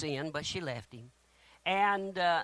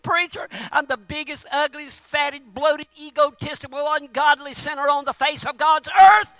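very high, loud, hammed-up wail; crying big old boy; 12 semitones higher, 15 dB louder than usual. Big man confesses to the preacher in a high, wailing mock-crying shout. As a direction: cry it out at full force, building to a shout. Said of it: "this big old boy started crying".

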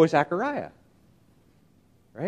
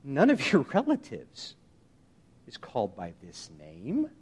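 perturbed; 7 semitones lower, 9 dB quieter than usual. Retold or imitated. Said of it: "looking a little bit perturbed".

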